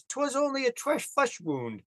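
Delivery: high-pitched, hammed-up British delivery; mock British accent. Host quoting a joke line to the narrator, mock British accent. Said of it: "add a British accent to this".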